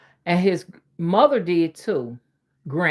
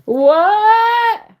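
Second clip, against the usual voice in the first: loud, high, startled what; a sudden shout of disbelief; surprised what; 12 semitones higher, 9 dB louder than usual. Real, not acted. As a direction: blurt it out in real surprise. Reacting to a viewer comment.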